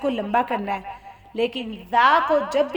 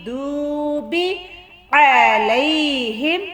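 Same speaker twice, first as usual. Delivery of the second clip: very slow, measured chant; Quran recitation; 2 semitones higher, 4 dB louder, much slower than usual. Shown as a demonstration. Teacher recites the verse for learners, slow chanted recitation. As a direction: draw each long vowel out on a held note.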